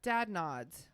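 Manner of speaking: ordinary delivery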